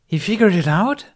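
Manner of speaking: high, loud astonished hiss; surprised hiss. A narrator's reading. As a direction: blurt it out in shock. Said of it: "Obi-Wan hissed over the comm".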